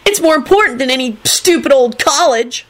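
high, impassioned protest; shriek. A narrator's reading. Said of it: "he shrieked".

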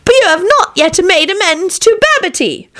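high witch voice; witch stump. A narrator's reading. Stump answering the king, high witch voice. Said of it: "said the stump".

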